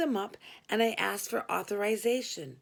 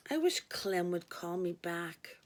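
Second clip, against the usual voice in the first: usual speaking voice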